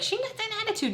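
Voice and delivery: very high-pitched mocking imitation; mocking mimicry. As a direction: parrot their complaint way up high. Mimicking others' complaint about her.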